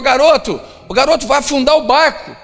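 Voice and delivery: high, loud warning shout; panicked yell. Acted. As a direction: yell at the boy over the storm. Passenger yelling at the boy.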